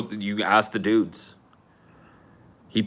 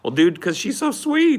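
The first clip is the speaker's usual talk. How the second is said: gushing; 12 semitones higher, 6 dB louder than usual. Real, not acted. Gushing about the mom.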